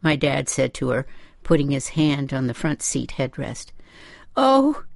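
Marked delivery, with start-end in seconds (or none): 4.4-4.8 high, distressed moan; upset mother's high moan. A narrator's reading. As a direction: moan it out, pitched way up. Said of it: "she moaned".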